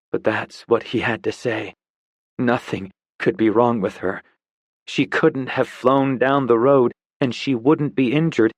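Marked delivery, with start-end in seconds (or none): none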